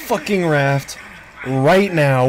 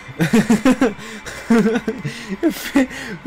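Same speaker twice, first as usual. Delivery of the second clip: long trailing giggle; breathy giggling; 3 semitones higher, 3 dB quieter than usual. Real, not acted. Streamer who can't stop laughing, giggling. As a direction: keep chuckling helplessly. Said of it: "I can't stop laughing".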